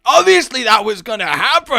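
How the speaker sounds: high, loud furious rant; furious yelling at a fallen door; angry rant. Retold or imitated. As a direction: rail against the injustice. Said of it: "I was so angry".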